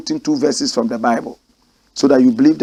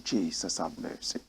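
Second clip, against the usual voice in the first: breathless whispered plea; whispered prayer; 9 semitones lower, 18 dB quieter than usual. Real, not acted. News reader whispers a plea for mercy. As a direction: whisper it to God, barely voiced.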